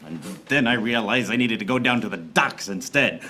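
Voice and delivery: ordinary talk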